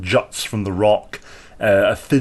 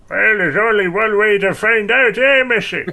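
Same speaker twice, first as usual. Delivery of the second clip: high, louder, cheeky teasing; sly halfling; 11 semitones higher, 4 dB louder than usual. Acted. Halfling teases Zell about werewolf size, high and cheeky. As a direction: tease her with a wink in the voice. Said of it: "Halfling goes".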